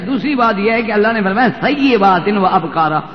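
ordinary talk

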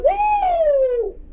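high, loud hype shout; excited whoop. Retold or imitated. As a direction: whoop it up like a fired-up crowd. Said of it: "I'm like".

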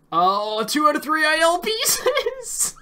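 high thrilled shout; excited cry. Real, not acted. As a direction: cry out with sudden glee. Thrilled by lucky pieces.

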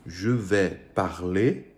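slow, careful French; slow French example. Shown as a demonstration. Teacher modelling the French phrase slowly for the students. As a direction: lay out "je vais parler" word by word, very slowly. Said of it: "eles acabam escrevendo".

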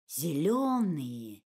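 ordinary delivery, nothing marked

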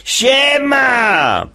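high cocky shout; shouted greeting. Acted. Jasiu bursting into class, high cocky shout. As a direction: yell it like a cheeky kid. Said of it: "kładzie nogi na ławkę i krzyczy".

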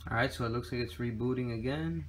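ordinary delivery

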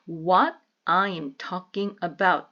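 slow, separated words, "What / I / am"; each word of "What I am talking about" on its own; slow teaching model, unlinked words. Shown as a demonstration. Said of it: "좀 연음하시면".

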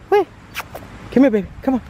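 high excited pet call; calling puppy. Real, not acted. Owner calling the puppy back, high excited call. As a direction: call her over in a high bright voice.

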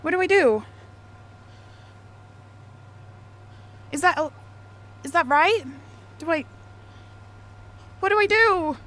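confused panic. Real, not acted. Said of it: "I'm so confused".

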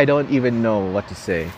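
ordinary delivery

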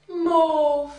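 ordinary delivery

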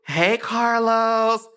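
high, slow, drawn-out greeting; singsong mock phone greeting; Sheree. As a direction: imitate a coy caller stretching out the hello. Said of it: "Sheree, is that you?".

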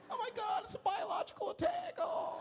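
high panicked shriek; mock panic, high and shrill; freaking out. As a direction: squeal like a terrified passenger. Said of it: "Now everybody freaks out".

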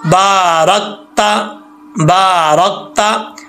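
बारकता slowly with a thick रा; heavy ra. Shown as a demonstration. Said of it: "तो रा जो है वो मोटा पढ़ा जाएगा".

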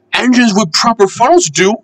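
high-pitched cheeky little engine. A narrator's reading. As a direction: answer back pertly in a high voice. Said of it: "said Percy in his cheeky way".

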